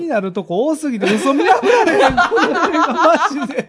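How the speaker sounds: high, loud complaint through real laughter; laughing exasperation. Real, not acted. Guesser unable to spot the lie in this film, laughing exasperation. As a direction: give up, helpless with laughter.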